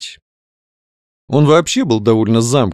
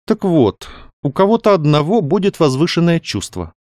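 higher, lively lady planning aloud; lady novelist, animated; 6 semitones higher than usual. A narrator's reading. Каролина explains the new plot to the doctor, animated. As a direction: lay out your plot with growing interest.

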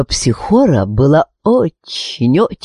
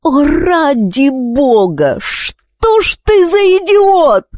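slow, high-pitched moan; drawn-out, exasperated wail; exasperated groan; much slower than usual. A narrator's reading. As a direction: groan the insult out in despair. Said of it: "простонала королева".

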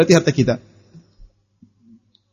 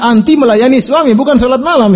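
higher, insistent scolding; raised preacher's rebuke; emphatic rebuke; 9 semitones higher, 3 dB louder than usual. Real, not acted. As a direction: drive the point home to the wife.

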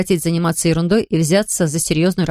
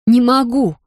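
higher, louder panicked cry; hysterical cry; 4 semitones higher, 3 dB louder, slower than usual. A narrator's reading. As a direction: blurt it out in panic. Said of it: "Голос трубки прозвучал истерично".

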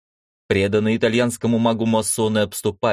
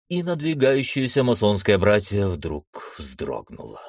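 ordinary delivery

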